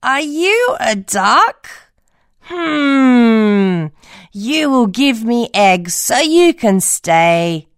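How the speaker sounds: put-on old woman voice. Old woman in the hut questioning the duckling, slow and high. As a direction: peer at the odd creature.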